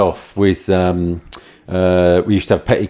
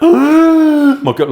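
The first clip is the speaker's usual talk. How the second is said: gasp, then a much higher and louder "my God"; shocked gasp; 5 dB louder than usual. Real, not acted. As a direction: caught off guard by what you just saw. Watching him kick concrete ball.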